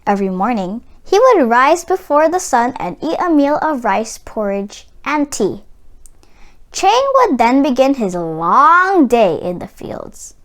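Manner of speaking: ordinary delivery, nothing marked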